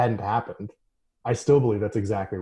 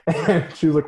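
normal talking voice

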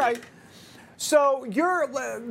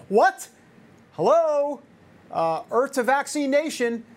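incredulous; 5 semitones higher, 4 dB louder than usual. Real, not acted. Disbelief at vaccine news.

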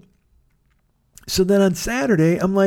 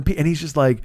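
ordinary delivery

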